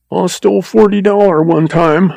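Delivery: high, tearful confession; blubbering. A narrator's reading. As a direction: confess in panic, pitched high. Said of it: "blubbered the agent".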